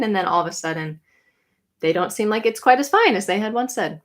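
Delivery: normal talking voice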